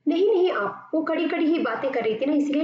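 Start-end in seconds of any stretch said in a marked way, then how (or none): 0.1-0.9 high pleading no, no; urging her to stay. Acted. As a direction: stop her, pitched up high. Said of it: "इस अंदाज से कहती है".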